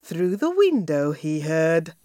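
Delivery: plain reading voice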